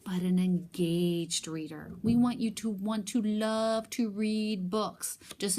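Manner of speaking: slow, earnest teacher voice on "engaged reader"; emphatic slow encouragement. Shown as a demonstration. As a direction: draw it out to inspire the kids. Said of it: "teacher urging kids to read".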